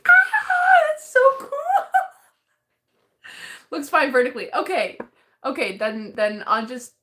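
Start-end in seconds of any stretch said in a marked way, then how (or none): 0.1-3.7 very high, loud, tearful squeal; overwhelmed delight. Real, not acted. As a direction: squeal it, real joy close to crying. Thrilled by viewer's postcard.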